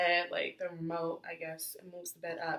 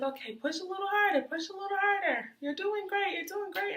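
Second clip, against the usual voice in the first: higher, sing-song cheerleading nurse; imitating nurse; 12 semitones higher, 5 dB louder than usual. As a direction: cheer her on like a sweet nurse. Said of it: "they're like".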